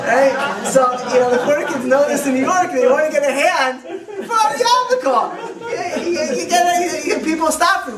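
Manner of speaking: amused, giggly retelling of the joke; laughing through it. Real, not acted. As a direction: keep laughing as you explain the punchline. Laughing at his own joke.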